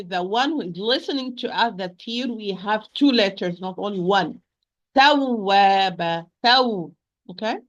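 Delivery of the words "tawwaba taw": Tawwaba slow and louder, taw pushed; nabr on tawwaba. Shown as a demonstration. Teacher models stressed tawwaba for student. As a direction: stress the doubled waw in Tawwaba. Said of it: "raising my voice a little bit".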